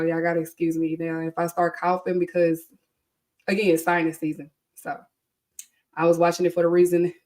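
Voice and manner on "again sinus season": usual speaking voice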